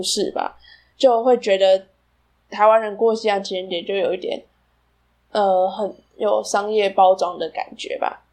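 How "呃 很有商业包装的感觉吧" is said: usual speaking voice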